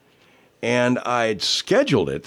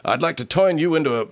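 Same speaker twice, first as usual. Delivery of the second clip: lightly imitated Southern film comic, higher; Oliver Hardy; 7 semitones higher than usual. Host quoting a comedian's line, light impression. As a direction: say "toin" for turn in a raised voice. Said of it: "as well as Oliver Hardy".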